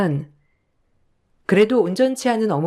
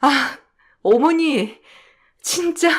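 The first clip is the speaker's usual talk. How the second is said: exasperated; 6 semitones higher, slower than usual. Acted. Wife exasperated with mother.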